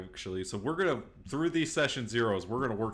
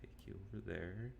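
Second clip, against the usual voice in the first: muttering; 17 dB quieter than usual. Real, not acted. Muttering aside while setting map.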